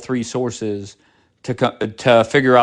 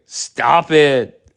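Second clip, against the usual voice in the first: usual speaking voice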